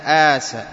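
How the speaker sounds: آسى slowly, with a long opening "aa"; slow, smooth "aaasaa" for آسى; madd vowel. Shown as a demonstration. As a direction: stretch both vowels of آسى. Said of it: "وكذلك ما مثلها".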